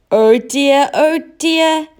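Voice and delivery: high, loud sniffly clown; a sad clown sniffling out his woe; the lost clown sniffling to the cat. A narrator's reading. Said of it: "sniffed the clown".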